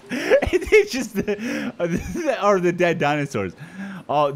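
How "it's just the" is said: breathy laugh spilling into slow words; can't stop laughing. Real, not acted. Streamer laughing at the dead bodies on screen.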